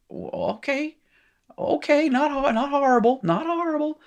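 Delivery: very high, strained pitch; hammed-up, high-pitched attempt at coping; mock reassurance. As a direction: talk yourself down in a squeaky high voice. Acts out a student coping.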